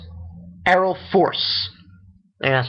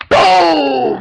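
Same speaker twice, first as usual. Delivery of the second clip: high, loud explosion shout; hammed-up impact yell; shouted boom; 10 semitones higher, 7 dB louder than usual. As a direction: punch out the blast sound. Acting out the fight's blast.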